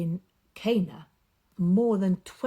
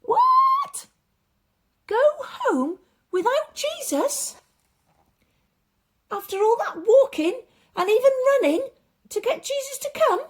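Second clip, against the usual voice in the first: squeaky, much higher, loud outburst; shocked disbelief; 8 dB louder than usual. Acted. The father reacting to Jesus's answer, shocked and squeaky. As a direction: burst out in disbelief, fast.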